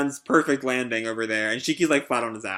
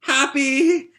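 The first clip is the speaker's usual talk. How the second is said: much higher, calling out with joyful tears; a high tearful shout of relief; tearful cry; 11 semitones higher than usual. Acted. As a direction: run to your friend crying his name. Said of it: "starts tearing up and starts weeping".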